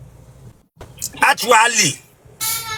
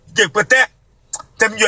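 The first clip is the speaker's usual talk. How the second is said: ordinary talk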